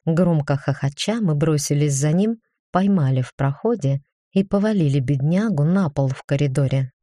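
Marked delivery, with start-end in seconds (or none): none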